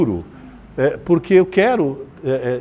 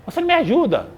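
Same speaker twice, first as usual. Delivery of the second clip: sharply higher reproach; accusing complaint; 11 semitones higher, faster than usual. Retold or imitated. A spouse blaming their partner at home, in a high, accusing reproach. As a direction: throw the blame at the partner. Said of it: "preciso externar aquilo que eu quero".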